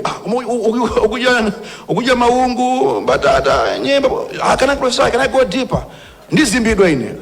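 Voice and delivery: mocking whine. Mimicking a complaining hoarder.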